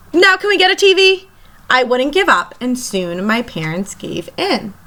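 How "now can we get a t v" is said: put-on begging kid. Child begging again.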